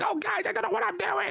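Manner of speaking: put-on, piercingly high whine; mock an annoying streamer in a very high voice; high-pitched whiny impression. Imitating how a streamer usually talks, whiny and high-pitched. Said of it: "really high pitch".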